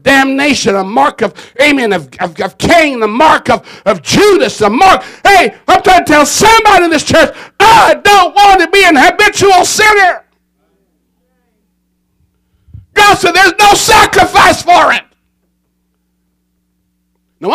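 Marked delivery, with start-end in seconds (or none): 5.2-15.0 raised, loud, higher voice hammering the warning; shouted preaching. Real, not acted. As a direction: shout it at the pews.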